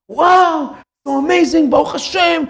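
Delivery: high, thrilled gushing voice; overjoyed mother. Retold or imitated. Mother hearing the dowry was found, high and overjoyed. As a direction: squeal it out like a relieved mother.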